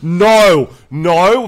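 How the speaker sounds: big hammed-up protest; emphatic mock denial. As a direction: disclaim it loudly for anyone listening in. Mock denial of inciting a coup.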